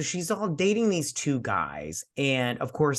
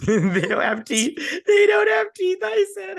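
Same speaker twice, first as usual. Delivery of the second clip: mock squeal; 8 dB louder than usual. Mocking British teeth joke.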